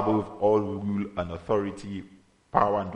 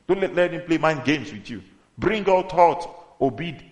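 loud, impassioned sermon delivery, rising higher; full-force preaching; 4 semitones higher, 6 dB louder than usual. Acted. Preacher tells believers they are set free, full-force preaching. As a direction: drive the message home to the congregation.

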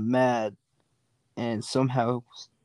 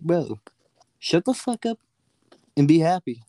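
1 mock scolding; 4 semitones higher than usual. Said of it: "I'm like".

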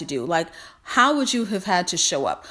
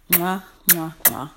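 blown kisses. Real, not acted. Blowing kisses goodbye to viewers.

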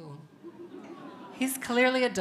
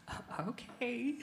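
slow, higher, hesitant reply; hesitant partner; 6 semitones higher, 10 dB quieter, slower than usual. Retold or imitated. Partner answering her warning of serious questions, hesitant and soft. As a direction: stammer a soft, uneasy okay.

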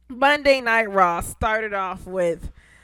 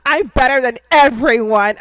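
mocking Carmella; 9 dB louder than usual. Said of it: "Carmella being a bitch".

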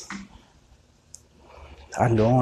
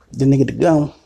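usual speaking voice

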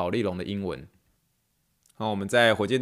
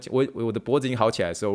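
usual speaking voice

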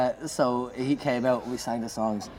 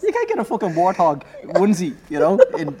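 excited protest; 10 semitones higher, 11 dB louder than usual. Real, not acted. Laughing loud high protest.

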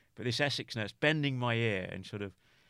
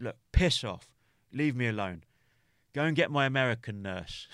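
louder, irritable retold outburst; snapped back; 2 semitones higher, 6 dB louder than usual. Patient snapping back at the nagging Essex nurse, retold irritation. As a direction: snap loudly to send someone away. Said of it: "I just snapped back".

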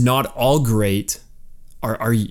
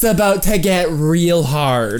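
mock Southern accent; 6 semitones higher than usual. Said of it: "they don't say it in a Southern accent".

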